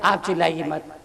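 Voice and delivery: quiet counter to the shout; soft and low. Real, not acted. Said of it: "चिल्लाने के लिए तरुण सागर बहुत है".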